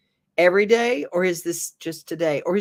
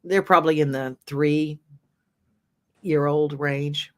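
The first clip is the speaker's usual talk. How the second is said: normal talking voice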